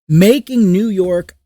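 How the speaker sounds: usual speaking voice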